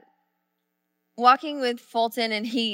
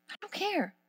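soft insistence; 8 semitones higher, 11 dB quieter than usual. Real, not acted. Soft emphatic aside.

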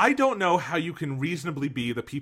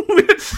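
high laugh; 9 dB louder than usual. Real, not acted. Cracking up at the line.

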